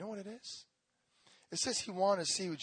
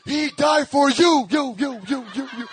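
slow, booming preacher shout that fades over the repeats; imitating a preacher yelling; 6 semitones higher, 18 dB louder, slower than usual. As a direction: hammer each "you" like a street preacher.

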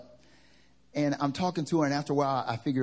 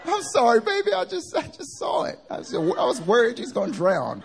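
high, louder apologetic mom; 12 semitones higher, 6 dB louder than usual. Retold or imitated. Son imitating mom apologizing to him in a high fretting voice. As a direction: coo it like a fretting mother.

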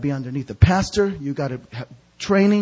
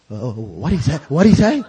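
lightly imitated Spanish accent; puzzled question with a hint of Spanish accent; Sammy; 3 semitones lower than usual. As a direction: ask it with a slight Spanish accent. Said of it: "he's Spanish".

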